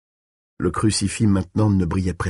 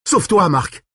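high urgent shout; crying out; 10 semitones higher, 4 dB louder than usual. A narrator's reading. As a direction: shout a warning to get away. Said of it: "s'écria Callahan".

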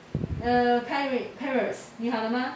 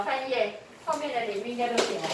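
usual speaking voice

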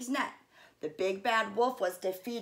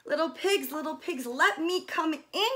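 higher, demanding wolf call; 6 semitones higher than usual. A narrator's reading. Wolf demanding entry at the brick house. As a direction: insist they open up. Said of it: "demanded the wolf".

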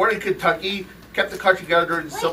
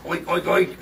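pig oinking; 2 semitones higher than usual. Acted. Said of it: "you're a pig".

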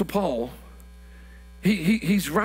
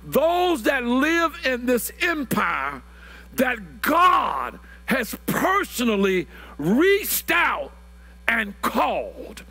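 slow emphatic preaching; 3 semitones higher, much slower than usual. Acted.

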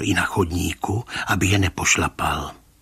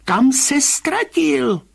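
very high, drawn-out demand; stubbornly insistent; 5 dB louder, much slower than usual. A narrator's reading. Rybka demanding where the man vanished, slow high insistence. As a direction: stretch it out high, refusing to let go. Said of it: "naléhal tvrdošíně pan Rybka".

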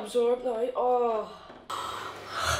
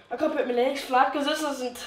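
ordinary talk, nothing marked

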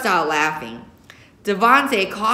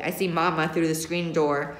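usual speaking voice

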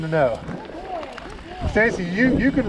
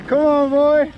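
calling from far away; 10 semitones higher, slower than usual. Real, not acted. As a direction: call out to the running dog. Calling dog running far ahead.

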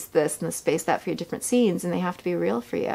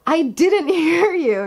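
high, loud, slow shout with a laugh in it; laughing mock yell; 11 semitones higher, 6 dB louder, slower than usual. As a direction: yell back across the wind, laughing. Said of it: "I go".

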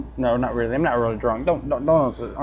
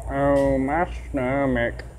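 slow, soft groaning Cleveland; a touch of Cleveland in pain; 3 semitones lower, 6 dB quieter, slower than usual. Acted. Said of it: "how he became Cleveland".

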